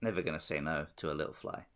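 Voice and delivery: low joking aside. Real, not acted. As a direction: toss off a deadpan quip. Joking about plane ride.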